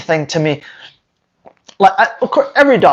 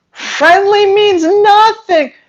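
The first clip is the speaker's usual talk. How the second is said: much higher, slow, strained exasperation; exasperated; 11 semitones higher, slower than usual. Real, not acted. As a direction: drag it out in disbelief. Exasperated rant about breeders.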